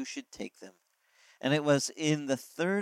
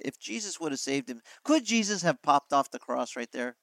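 ordinary talk, nothing marked

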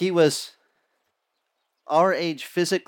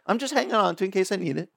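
hurried excuse; 4 semitones higher, faster than usual. Pretend. His excuse to God.

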